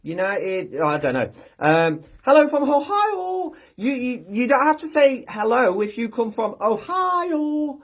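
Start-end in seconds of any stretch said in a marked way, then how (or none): 2.2-3.5 high, slow, stretched-out "Ohio"; drawn-out Ohio greeting. Acted. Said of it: "just walked in and gone". 3.8-7.8 high, slow, stretched-out teasing; playful teasing. Pretend. Playful teasing of Ohio greeting.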